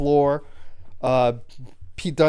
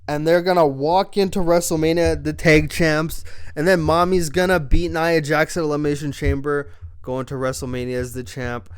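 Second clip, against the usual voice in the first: ordinary talk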